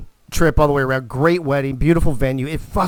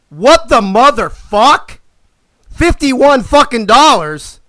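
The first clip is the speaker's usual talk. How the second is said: slow, high, loud disbelieving shout; shocked outraged yell; 8 semitones higher, 7 dB louder, much slower than usual. Retold or imitated. At the parking machine, a slow outraged yell at the price. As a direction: bellow it out like the price is a crime. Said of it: "this is my expression".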